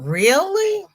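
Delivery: high, loud rising question; incredulous. Real, not acted. Streamer learning Jennifer loves pink yarn, incredulous squeal. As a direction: swoop up high in disbelief. Surprised by viewer's love of pink.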